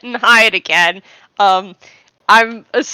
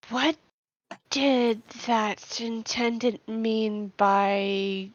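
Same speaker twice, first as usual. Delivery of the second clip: soft, puzzled character voice; quiet confused voice; 3 semitones lower, 11 dB quieter than usual. Acted. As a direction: wonder it aloud, hushed. Vashi voiced by player.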